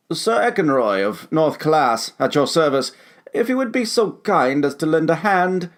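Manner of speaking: a trapped knight politely asking for help; gallant knight. Acted. Knight figure voiced by narrator.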